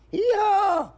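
very high, loud squealed hee-haw; piercing donkey call; donkey bray. Retold or imitated. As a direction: bray way up in falsetto. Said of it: "says the donkey".

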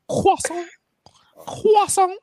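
whispered, high, breathy French "Croissant"; French-style croissant. Shown as a demonstration. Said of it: "You gotta add the French bit".

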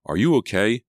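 high, loud, rising check; worried question. A narrator's reading. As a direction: ask with urgent concern. Checking friend after near crash.

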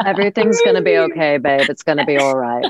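mock reassuring character voice, playful; character quote. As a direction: tease with a hint of the character's line. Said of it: "I never said babe ever".

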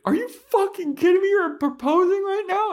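very high, loud indignant shriek; outraged Anya. Retold or imitated. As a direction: shriek at him in disbelief. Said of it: "she's like".

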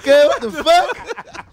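drawn-out high mock outrage; stunned disbelief. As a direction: act floored by what you just saw. Retelling his shock at the stage.

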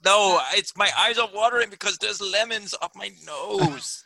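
high, whiny tearful protest; mock crying. Denying he is crying over lemons, high mock-tearful voice. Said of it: "I think he's crying now".

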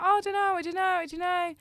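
high, loud flustered friend voice; imitating sweet friend. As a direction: mimic a lovely girl at a loss, high-pitched. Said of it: "a very lovely girl".

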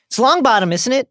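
high, loud mocking sneer; sneering Malfoy. A narrator's reading. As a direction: sneer the name down at him. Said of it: "sneered Lucius Malfoy".

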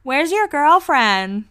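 high, slow, drawled tipsy imitation; little drunk voice. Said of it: "in her little drunk voice".

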